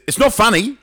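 put-on wounded little boy's retort, pitched right up; indignant protest. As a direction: act out an affronted child. Offended kid scolds the amused teacher.

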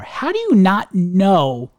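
slow, emphatic disbelief; slowly hammering an exasperated question; real incredulity. Real, not acted. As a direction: drag out each word in exasperated disbelief. Incredulous rant at the truck driver.

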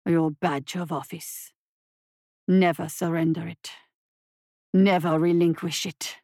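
low stern creature; growling monster. A narrator's reading. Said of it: "growled Zhenbu".

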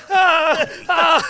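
high, loud mock panicked whimper. Friend acting out drowning in small talk, high panicked whimper. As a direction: flail with a high strangled "ah, ah".

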